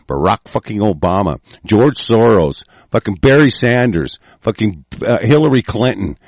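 normal talking voice

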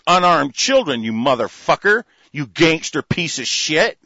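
ordinary talk

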